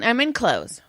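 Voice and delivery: ordinary talk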